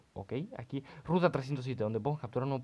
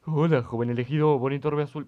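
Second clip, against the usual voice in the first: louder, a hint of a solemn stranger; mysterious stranger voice; 9 dB louder than usual. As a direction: read the stranger's greeting with weight. Reads NPC line loudly. (desconocido misterioso, voz solemne)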